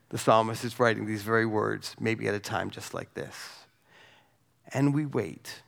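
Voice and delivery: ordinary talk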